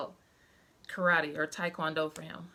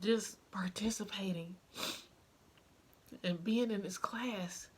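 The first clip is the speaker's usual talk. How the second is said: tearful whisper; 6 dB quieter than usual. Real, not acted. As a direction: voice cracking to a hush over her son. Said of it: "bawled like a baby".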